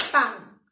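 Pan said loud with a single clap; one clap. Shown as a demonstration. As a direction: clap Pan once, firmly. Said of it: "Pan tiene una sola sílaba". (Pan con una sola palmada, en voz alta)

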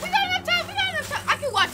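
very high, excited squeal. Real, not acted. Player realizing there is time left, excited squeal. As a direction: blurt it way up high.

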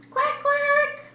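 high squeaky quack quack; high duck quack. Acted. Duck sound.

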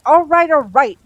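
loud, high, exasperated cut-in; irritated snap. A narrator's reading. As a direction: cut them off with loud impatience. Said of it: "Crowley snapped".

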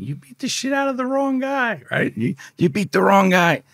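laughing mock taunt. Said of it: "they're laughing".

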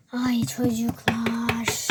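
slow exasperated whisper; whispered sigh. Acted. Exasperated by the kids, slow whispered sigh. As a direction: breathe it out wearily.

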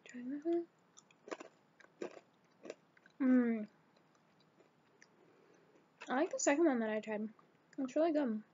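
chewing while talking; talking between bites; mouth full. Real, not acted. Seen 1: hand feeds small snacks to mouth, chews while speaking.

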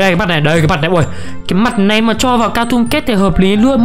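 ordinary delivery

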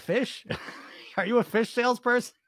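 high, laughing mock question; giggling, incredulous jab; laughing tease. Real, not acted. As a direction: laugh while teasing her about fish. Teasing the commenter about fish.

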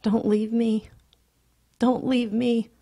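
high, loud, desperate; cling and beg through tears; terrified child crying out; crying plea. A narrator's reading.

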